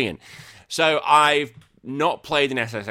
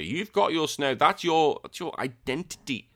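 normal talking voice

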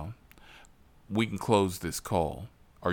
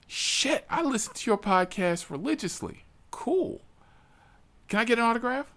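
very high, breathless fanboy gush. Retold or imitated. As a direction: squeal it like meeting a celebrity. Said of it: "He's like".

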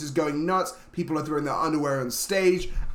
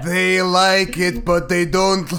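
put-on slow, louder Transylvanian accent, drawn out; 6 dB louder, slower than usual. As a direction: do a hammed-up Transylvanian count. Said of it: "This is Transylvanian".

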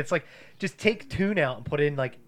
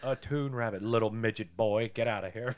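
menacing judge voice; 9 dB louder than usual. Retold or imitated. Voicing Judge Doom.

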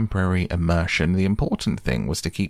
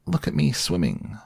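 squeaky Roo voice; 7 semitones higher than usual. Acted. Roo bubbling proudly.

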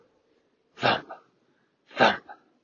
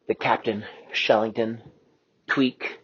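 plain reading voice

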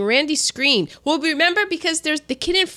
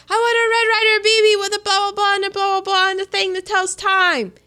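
higher, louder and fast, hammed-up eager kid; excited blurt; 8 semitones higher, 4 dB louder, faster than usual. Ralphie climbs back up the slide shouting his wish, hammed-up excitement. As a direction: blurt it out all at once. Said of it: "he's like".

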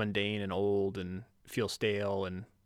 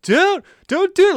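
much louder and higher, begging her to stop; pleading shout; 14 dB louder than usual. Retold or imitated. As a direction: yell don't do it in desperate mock alarm. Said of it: "I was like".

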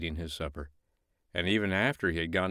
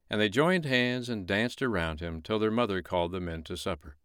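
plain reading voice

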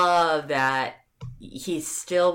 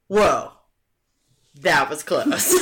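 ordinary delivery

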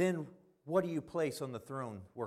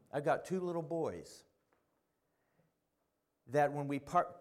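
usual speaking voice

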